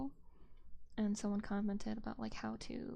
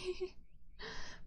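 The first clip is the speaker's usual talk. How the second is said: quick airy giggle, pitched up; a light breathy laugh; high breathy giggle; 9 semitones higher, 5 dB quieter than usual. Real, not acted. Laughs after joke.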